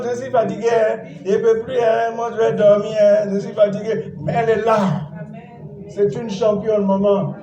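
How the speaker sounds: ordinary talk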